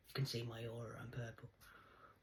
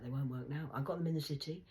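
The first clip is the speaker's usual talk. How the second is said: ordinary delivery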